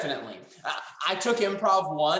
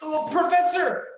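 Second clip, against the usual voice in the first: dramatic call; 9 semitones higher, 3 dB louder than usual. Acted.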